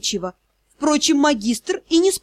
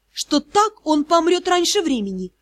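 ordinary delivery, nothing marked

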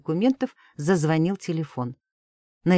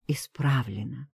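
breathy whisper, softer; 3 semitones lower, 5 dB quieter, slower than usual. Acted. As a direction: whisper the last word. Quiet final word on phone.

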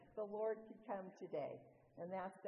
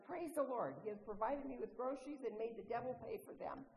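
joyful shout; 4 semitones higher than usual. Retold or imitated. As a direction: shout it out, arms up in triumph. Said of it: "and shouted".

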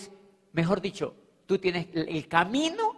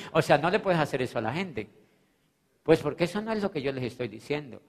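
usual speaking voice

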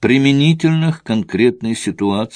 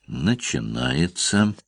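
slow, hushed murmur, softer than narration; understated near-whisper; 3 semitones lower, 7 dB quieter, slower than usual. A narrator's reading. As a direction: say it low and drawn out, barely aloud. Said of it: "прошептал Алексей".

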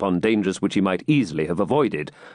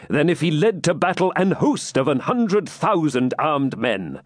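higher, declamatory royal voice; stately, proud declaration in a raised pitch; proud king; 7 semitones higher than usual. A narrator's reading. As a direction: boast grandly of adventure.

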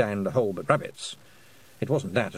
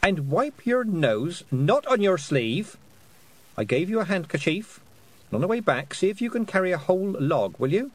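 bossy big sister; 8 semitones higher than usual. A narrator's reading. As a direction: order a little one about like a parent.

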